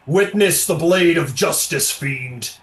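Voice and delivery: commanding arbiter. Acted. Arbiter raises the blade at the fiend, commanding. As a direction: proclaim it boldly at the fiend.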